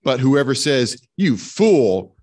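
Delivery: ordinary talk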